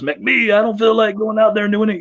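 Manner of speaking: high-pitched imitation of a reluctant wrestler; mock complaint, voice pushed up high; Dion. Wrestler balks at working twice, imitated high-pitched grumble. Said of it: "He goes".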